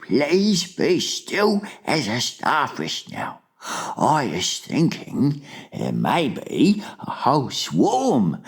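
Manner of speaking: slow, high giant character voice; fussy gentle giant. A narrator's reading. Giant figure speaking to Sophie.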